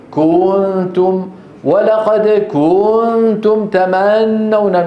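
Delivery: كنتم said kuuntum, u dragged long; mispronounced كنتم, drawn-out vowel; wrong stretched damma. Shown as a demonstration. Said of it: "ما بيصح هذا العمل".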